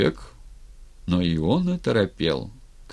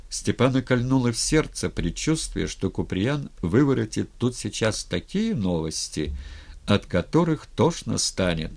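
ordinary delivery

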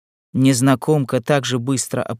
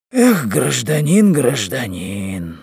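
reproachful sigh; much slower than usual. Acted. Loren reproaches the chief.

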